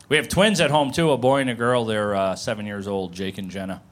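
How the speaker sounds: ordinary talk, nothing marked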